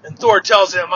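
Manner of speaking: ordinary delivery, nothing marked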